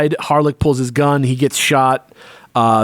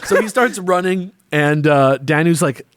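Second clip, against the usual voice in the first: ordinary talk, nothing marked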